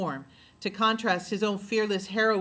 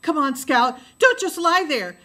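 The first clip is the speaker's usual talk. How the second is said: loud, high panicked yell; a brother screaming at his dazed sister; 7 semitones higher, 8 dB louder than usual. A narrator's reading. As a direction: scream at her to get up. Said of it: "Jem was screaming".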